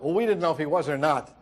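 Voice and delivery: ordinary talk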